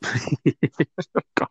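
ordinary talk

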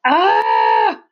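loud high furious roar. A narrator's reading. Charlie roaring as he chases Finn, furious. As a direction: let out a raging yell. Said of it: "roared Charlie".

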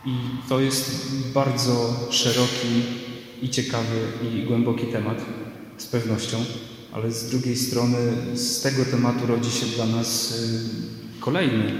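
usual speaking voice

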